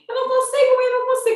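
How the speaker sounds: high, loud imitation of desperate cries; retold screaming. Son tells mother he cannot cope, retold desperate yelling. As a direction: cry out helplessly to mum, pitch way up. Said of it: "aí ele começou a gritar".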